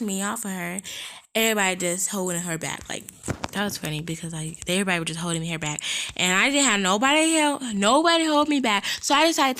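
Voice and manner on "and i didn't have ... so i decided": raised, louder indignant burst; real indignation spilling over; heated outburst. Real, not acted. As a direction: let the unfairness burst out loud. Heated retelling of fight.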